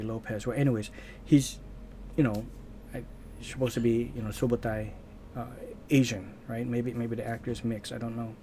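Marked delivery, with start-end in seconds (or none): none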